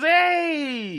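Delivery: high, loud drawn-out holler; mock shout. Recapping Bishop pulling up on Steel, mock shouted hail. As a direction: call out as if surprising someone.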